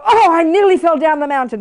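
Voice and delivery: high-pitched acted gasp of a sentence; alarmed exclamation. Driver who nearly slipped down the mountain, mock alarm. Acting out a near-fall exclamation.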